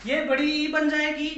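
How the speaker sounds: ordinary delivery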